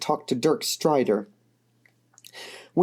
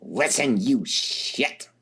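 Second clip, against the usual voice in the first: growled; 2 semitones lower, much slower than usual. A narrator's reading. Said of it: "she growled".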